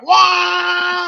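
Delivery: shouted vibration noise. Retold or imitated. Imitating the top setting going off, with a shouted wah. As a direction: blast it like the toy on full speed.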